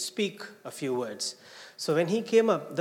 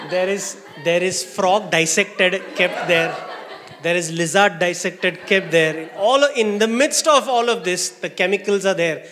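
laughing; 3 semitones higher, 10 dB louder than usual. Real, not acted. Laughing, describing lab scene.

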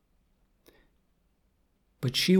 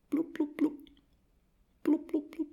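tiny high, soft bloops; imitated bubble blips; 5 dB quieter than usual. Goldie swims on, ignoring Kat, small high bubbles. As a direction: make little fish bubbles with your mouth.

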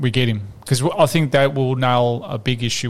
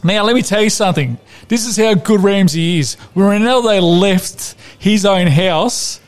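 higher, hammed-up Italian-flavoured tirade; mock outraged rant; 5 semitones higher than usual. Podcast host ranting at Ramsey, hammed-up outrage. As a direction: rant at him like an exasperated nonna.

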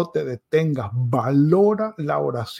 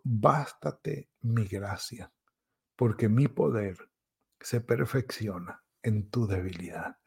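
softly quoted scripture; 4 semitones lower, 6 dB quieter than usual. Acted. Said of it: "Le dijo a Pablo".

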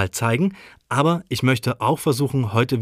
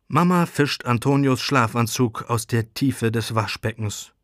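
usual speaking voice

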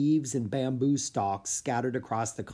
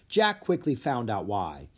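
ordinary delivery

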